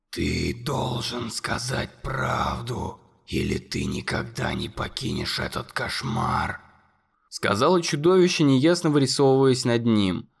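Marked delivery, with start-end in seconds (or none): 0.1-6.6 very low, slow, eerie growl; eerie monster voice. A narrator's reading. Monster warning Conor, low and eerie. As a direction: make it sound like doom. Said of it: "Его голос звучал жутко".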